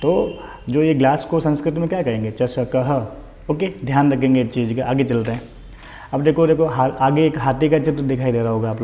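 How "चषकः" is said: चषकः with an audible final "ha"; sounded visarga. Shown as a demonstration. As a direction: say चषकः as "chashaka-ha". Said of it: "ह की ध्वनि निकलती है".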